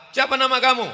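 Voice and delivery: high, loud, quick stern examiner; booming future father-in-law; stern tester. Acted. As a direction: grill the suitor from up high. Tester questions the suitor.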